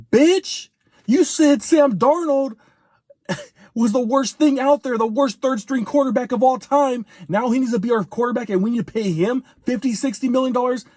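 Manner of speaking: furious rant at a flip-flopping pundit; angry call-out. Real, not acted. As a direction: blast him for changing his story. Calling out a pundit's flip-flop.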